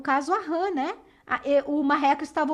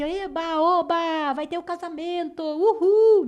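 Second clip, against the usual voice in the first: mock cheering; 3 semitones higher than usual. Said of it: "todo mundo estava ali".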